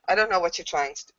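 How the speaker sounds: hurried denial; rushed, defensive reply; a bit too quick. Acted. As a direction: say it too fast, covering up. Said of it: "comes out just a little bit too quick".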